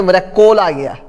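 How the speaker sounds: "कॉल" said as kol, rounded o; wrong o vowel. Shown as a demonstration. Teacher mimicking a mispronunciation, "कॉल" as kol. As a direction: close the vowel of "कॉल" to a long o. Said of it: "ये भी नहीं बोलते".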